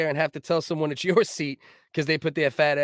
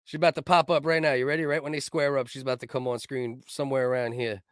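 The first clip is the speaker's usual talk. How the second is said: usual speaking voice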